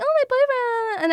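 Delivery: high-pitched mock swoon; mocking, high sing-out; gushing friend. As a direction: imitate a girl gushing, voice jumping up and sliding down.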